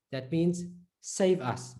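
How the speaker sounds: ordinary talk, nothing marked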